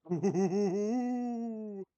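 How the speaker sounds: ghostly giggle. Acted. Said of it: "my ghost voice".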